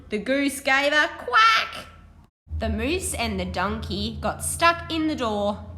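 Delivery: animated picture-book reading; reading to children. Acted. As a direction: read it brightly to a class of children. Teacher reads picture book aloud.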